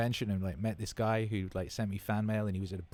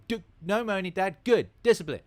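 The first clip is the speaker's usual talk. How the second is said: put-on much higher, a small boy telling his dad off; kid scolding dad; 11 semitones higher, 7 dB louder than usual.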